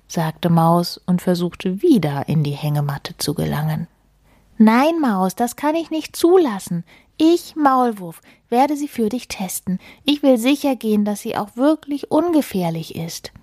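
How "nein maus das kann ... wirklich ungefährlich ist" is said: high-pitched, emphatic storybook protest; self-important, lightly voiced refusal; insistent mole. Mole forbidding mouse to climb in, emphatic high-pitched insistence.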